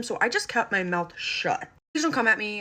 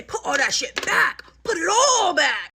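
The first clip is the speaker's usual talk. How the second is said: drawn-out, raised scolding; slow, emphatic order to a kid; exasperated command; 7 semitones higher, 5 dB louder, slower than usual. Acted. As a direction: lay down the law loudly. Her scolding advice to the mom.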